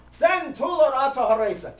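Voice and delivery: high, loud chanted echo; loud echoing call; yelled back. Retold or imitated. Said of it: "And the president would yell".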